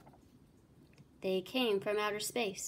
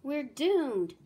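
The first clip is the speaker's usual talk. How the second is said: despairing cry; 5 semitones higher, 6 dB louder than usual. Acted. Shekel despairs.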